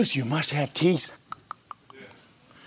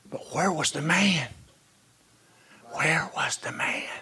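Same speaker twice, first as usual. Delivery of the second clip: whispered, much softer question; hushed question; 5 semitones lower, 8 dB quieter than usual. Acted. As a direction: drop to a whisper and ask it. Quiet rhetorical question in sermon.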